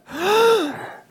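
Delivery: sharp, high theatrical gasp; mock gasp. Podcaster reacts to the secret crush reveal, mock-shocked gasp.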